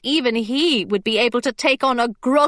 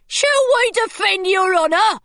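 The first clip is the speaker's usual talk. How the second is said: higher, keen pirate voice; eager pirate; 8 semitones higher than usual. A narrator's reading. A pirate offering to defend the captain, eager.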